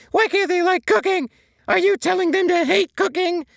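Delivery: very high, loud cartoon sponge, hurt and accusing; high, loud, indignant sponge character; SpongeBob voice, upset. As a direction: squeal it out like a wounded SpongeBob. SpongeBob voiced through argument.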